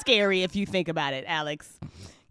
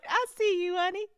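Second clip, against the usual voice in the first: higher, cooing sweetness with a lurking threat; sweet-voiced monster; 11 semitones higher than usual. Host plays a sweet monster spotting its victim, cooing high. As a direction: coo at your prey like a doting grandma. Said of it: "just sweet voice?".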